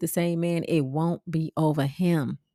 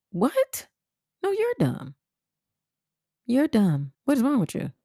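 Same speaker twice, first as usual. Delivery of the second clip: mock outrage; 7 semitones higher than usual. Mocking reply to insults.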